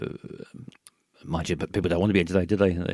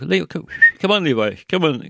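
higher, louder call to a dog; 9 semitones higher, 6 dB louder than usual. Pretend. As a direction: call the dog over in a raised voice. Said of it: "no, it wasn't his accent".

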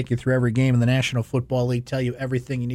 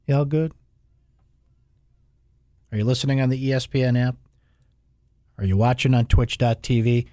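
ordinary talk

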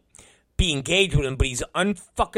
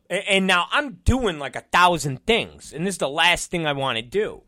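ordinary talk

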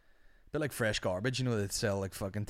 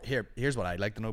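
ordinary delivery

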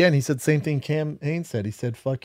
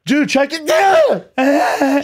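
a slow, very high yelp, loud; shouting; 14 semitones higher, 8 dB louder, much slower than usual. Real, not acted. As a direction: yell it out in surprise. Sudden shout at the video.

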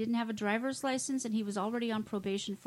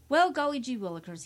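mock surprise; 3 semitones higher, 7 dB louder than usual.

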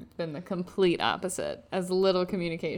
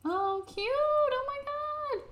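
very high, drawn-out delighted squeal; gushing squeal; slower than usual. Real, not acted. Co-host sees a photo on the phone, high gushing squeal. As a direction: coo over something adorable, way up high.

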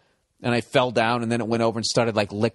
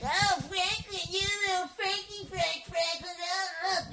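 very high, softer cooing at the dog; doting dog-talk voice; 8 dB quieter than usual. Retold or imitated. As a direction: gush over the dog by name. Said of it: "doing that shit".

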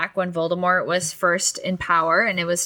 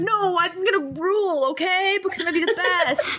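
high, cocky mock brag; pitched-up mock arrogance, racing at the end; bratty Harry; 11 semitones higher than usual. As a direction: brag up high like a spoiled kid. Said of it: "And he's like".

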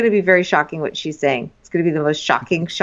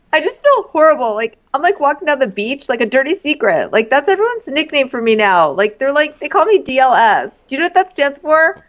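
higher, aggrieved imitation of her; mock distressed; 7 semitones higher, 4 dB louder than usual. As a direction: complain with hammed-up hurt. Acting out her upset complaint.

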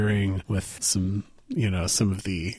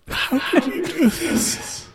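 high, slow, tearful mother's voice; crying mother; slower than usual. Acted. Weeping mother asks her child's killer, high and slow. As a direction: sob it out in the head. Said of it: "crying bitter tears".

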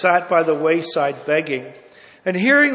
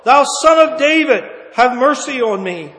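louder, pleading cry; crying out more; 7 semitones higher, 8 dB louder than usual. Acted. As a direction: beg even harder, loud. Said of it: "he cried so much the more".

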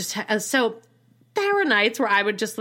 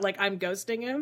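ordinary talk, nothing marked

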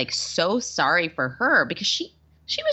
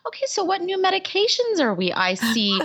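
lightly mimicked upbeat doctor, pitched up; mock-sweet doctor, higher; chirpy doctor, mock high voice; 14 semitones higher than usual. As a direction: play the cheerful gyno, bright and high.

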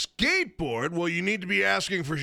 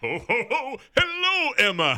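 jolly ho ho ho; 4 semitones higher than usual. Acted. Santa greets caller.

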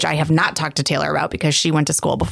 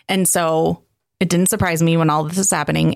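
usual speaking voice

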